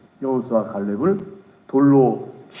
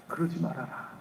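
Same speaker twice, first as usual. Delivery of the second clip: a much softer pleading voice; soft plea; 11 dB quieter than usual. Retold or imitated. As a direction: beg them gently, face down. Moses begging people, prostrate.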